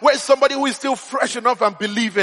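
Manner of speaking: rousing sermon, building in pitch and urgency; preacher rallying a congregation; impassioned preaching. Real, not acted. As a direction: drive each repeated question home like a preacher.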